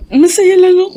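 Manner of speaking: loud, high tearful burst; crying happily. Acted. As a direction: sob out the happiness. (umiiyak sa tuwa, malakas at mataas)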